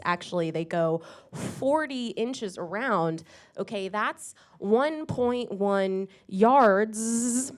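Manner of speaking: usual speaking voice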